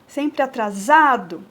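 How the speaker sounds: "atrasado" with za hit, final do faded; stress on za. Shown as a demonstration. Teacher shows the strong syllable of the verse. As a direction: lean on za in "atrasado". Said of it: "o za é a sílaba forte".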